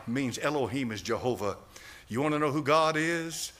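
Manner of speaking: ordinary talk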